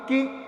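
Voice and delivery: کی with a short, unstretched i; short vowel. Shown as a demonstration. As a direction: cut کی short, no lengthening. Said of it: "خالی حرکت دی ہے". (کی کو بغیر کھینچے)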